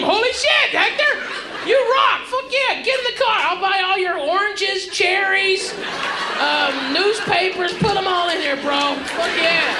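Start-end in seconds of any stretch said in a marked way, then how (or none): 0.0-7.7 thrilled. Retold or imitated. Stranded driver cheering Hector for fixing his car, high and excited. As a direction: burst out overjoyed at the mechanic.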